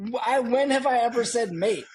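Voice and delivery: high, loud self-questioning; mock incredulous. Golfer catching himself saying mate, mock incredulous. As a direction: catch yourself in baffled disbelief.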